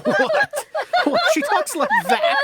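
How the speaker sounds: high, helpless laughter through words; laughing. Acted. Director amazed at madam's speech, laughing. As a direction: crack up in disbelief. Company bursts out laughing at accent.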